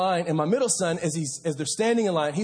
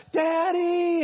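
high, drawn-out cry for dad; mock child's scream; 11 semitones higher than usual. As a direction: imitate a lost little boy screaming out. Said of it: "he's screaming".

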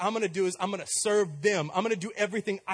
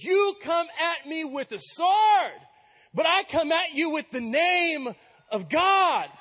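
slow, loud proclamation, louder and higher; bold command; 8 semitones higher, 5 dB louder, slower than usual. Retold or imitated. David answering the giant, slow, shouted, fearless. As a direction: declare it slowly with full force. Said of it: "This is what he says".

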